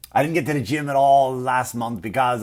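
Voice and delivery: ordinary talk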